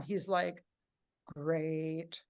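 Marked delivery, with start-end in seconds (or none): 1.3-2.2 low, lifeless "great"; unconvincing great. Retold or imitated. Robert failing to sound positive, unconvinced. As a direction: say it softly with no enthusiasm. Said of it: "that wasn't good".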